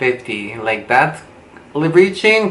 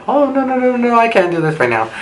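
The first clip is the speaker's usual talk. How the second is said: high squealing no's settling into panicked refusal; shrill panic; 11 semitones higher, faster than usual. Retold or imitated. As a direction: squeal in alarm then refuse. Seeing the dark hallway.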